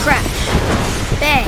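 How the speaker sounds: called out loud as impacts; loud crash words. A narrator's reading. Narrator voicing the crash, loud. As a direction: punch each sound like a hit.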